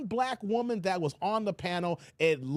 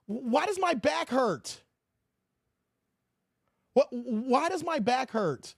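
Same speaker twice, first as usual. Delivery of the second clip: mock confusion; 4 semitones higher, slower than usual.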